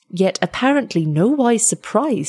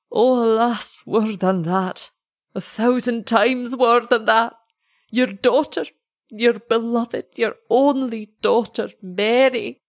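slow, higher, mournful voice of a heartbroken lover; grieving lament; 4 semitones higher than usual. Acted. Heartbroken lover tells the parents their daughter drowned, mournful and slow. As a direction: let the grief lift the voice and drag it out.

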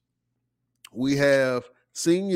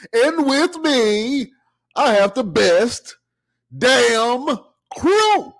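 hype intro; 10 semitones higher, 5 dB louder, much slower than usual. Acted.

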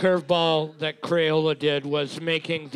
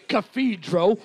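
imitation Cockney, higher pitched; mock Cockney accent; 3 semitones higher than usual. Co-host mimicking the host's accent, mock Cockney. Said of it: "That's Cockney".

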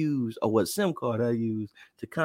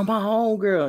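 normal talking voice

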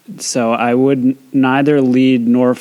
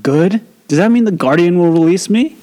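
excited; 6 semitones higher than usual. Acted. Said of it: "trying to keep the excitement out".

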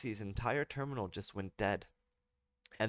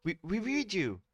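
startled officer; 11 semitones higher, 4 dB louder than usual. Acted.

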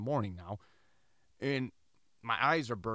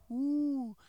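high hooting owl imitation, much higher; mock owl hoot; 12 semitones higher than usual. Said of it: "It was almost like a whoo".

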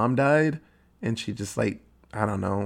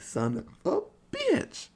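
squeaky high, hushed "son of a bitch"; mock high curse; 8 dB quieter than usual. Recapping the film, voicing Tree's hushed, high, squeaky realization. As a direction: squeak it out under your breath. Tree realizing roommate is killer.

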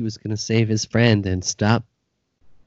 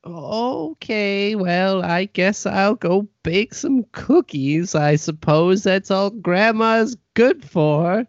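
put-on high, self-pitying old granny; guilt-trip like a martyred granny; grandma voice; 9 semitones higher than usual. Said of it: "Okay, Nana".

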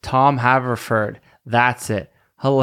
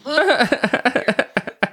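high, delighted burst of laughter; real laugh; 13 semitones higher than usual. Real, not acted. After playing the clip.

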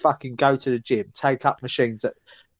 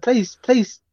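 pleading whine; 9 semitones higher than usual. Pretend. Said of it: "What are you doing that for?".

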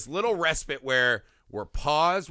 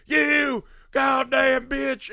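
slow, high, loud hammed-up yell; mock furious shout; 11 semitones higher, 6 dB louder, much slower than usual. Host voicing the enraged Sawyer, slow high shout. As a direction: drag it out in mock outrage. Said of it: "And he's like".

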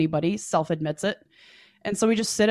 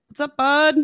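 casual guy voice; 7 semitones higher, 5 dB louder than usual. Retold or imitated. Date suddenly appears, voiced.

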